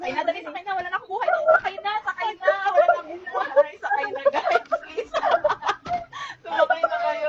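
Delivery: loud, high calls to hop on; hoarse jeepney route shouts. Real, not acted. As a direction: shout at friends to get aboard. Calling friends onto the vehicle.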